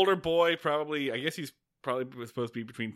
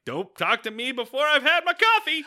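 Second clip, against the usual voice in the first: high, loud comic outburst; mock meme voice; 11 semitones higher, 8 dB louder than usual. Voicing an Ewok coffee meme on mom's Facebook, mock outburst. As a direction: yell it like an obnoxious slogan.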